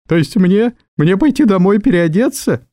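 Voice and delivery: incredulous secretary, lightly acted; taken aback. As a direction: ask it high, as if affronted. Secretary taken aback at dress rebuke.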